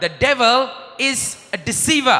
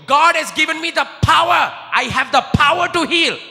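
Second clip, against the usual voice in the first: boastful healer; 6 semitones higher than usual. Retold or imitated. Preacher mocks a man boasting of healing power, swaggering. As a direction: brag like a proud false healer. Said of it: "If ever a man tells you".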